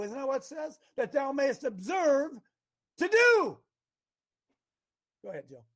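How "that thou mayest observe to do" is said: "to do" jumps high and loud; slow on "That thou mayest observe", then punch "do" high; stress on do. Shown as a demonstration. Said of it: "You observe to do".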